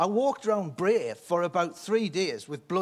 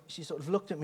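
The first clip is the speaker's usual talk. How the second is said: ordinary talk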